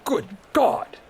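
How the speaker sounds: high, airy gasp of shock; startled wheeze. A narrator's reading. Boss startled by the booming god, wheezing. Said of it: "he wheezed".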